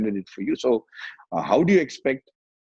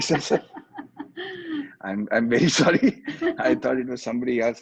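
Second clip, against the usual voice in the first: laughing apology; 2 semitones higher than usual. Retold or imitated.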